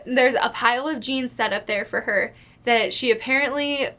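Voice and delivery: ordinary talk, nothing marked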